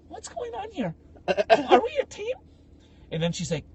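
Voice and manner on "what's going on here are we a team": high-pitched mock confusion; bewildered sister. Sister puzzled by her sudden ally, imitated high bewilderment. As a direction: imitate a baffled sister in a raised little voice. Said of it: "my sister's like".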